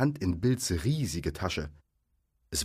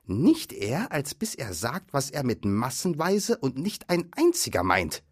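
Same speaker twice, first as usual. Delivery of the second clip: higher-pitched troll objection; insistent troll; 7 semitones higher than usual. A narrator's reading. Bert refusing to let Bilbo go, insistent. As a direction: refuse firmly as an argumentative troll.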